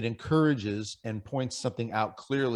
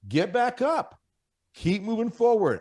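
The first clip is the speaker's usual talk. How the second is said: louder, much higher urging; rally the men to get back up; emphatic; 10 semitones higher, 5 dB louder than usual. Real, not acted. Host urging struggling men to keep going, emphatic and loud. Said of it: "I wanna emphasize this for the men".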